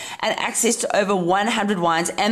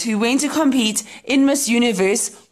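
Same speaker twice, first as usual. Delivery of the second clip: ordinary talk, nothing marked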